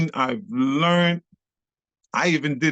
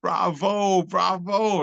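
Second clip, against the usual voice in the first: higher, drawn-out mock cheer; retold crowd cheer; 6 semitones higher, much slower than usual. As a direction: call it out like an applauding crowd. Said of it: "The crowd is screaming out".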